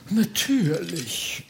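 slow, stretched-out single word; haughty Freiherr. Acted. Freiherr confirms he is an excellent musician, haughty. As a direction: draw it out as a proud nobleman.

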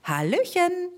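high chirpy greeting; twittering bird. Acted. Bird greeting everyone from the sky with a chirp. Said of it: "zwitscherte er".

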